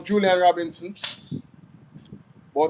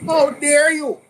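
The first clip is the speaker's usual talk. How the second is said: higher, louder mock outrage; mock outraged politician; 10 semitones higher, 6 dB louder, slower than usual. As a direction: ham up her indignation. Mocking a politician's speech.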